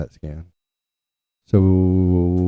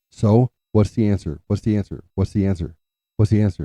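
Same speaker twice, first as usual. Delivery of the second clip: imitating an impatient mom, louder; impatient pressing; 3 semitones higher, 5 dB louder than usual. Mom pressing dad after the phone call, impatient. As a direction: fire off the question insistently, as a retold demand.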